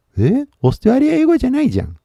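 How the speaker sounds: high, quick burst of disbelief; surprised reaction. Pretend. Learner hearing an unfamiliar accent, surprised. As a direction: pitch it way up, startled and fast.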